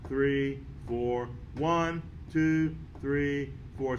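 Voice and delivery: slow, measured music count, each beat in time; counting beats aloud. Shown as a demonstration. Teacher counting through a piece with students, steady rhythmic count. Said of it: "let's say the counts".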